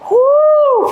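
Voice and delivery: loud, high "woo"; relieved whoop. Real, not acted. Coach ending the set, loud high whoop. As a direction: let out a big high whoop. Set of reps just finished.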